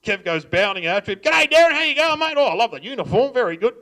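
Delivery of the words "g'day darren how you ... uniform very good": high, loud, jovial dad; dad greeting the cop he knows, booming and jolly; hearty greeting. Retold or imitated. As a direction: bound up and greet him heartily.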